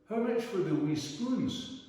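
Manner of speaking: a hint of a grand lady enquiring; voicing the Scottish lady.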